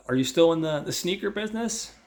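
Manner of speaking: high, loud mocking jab; loud teasing voice. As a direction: needle him, loud and high. [name] teasing Steve.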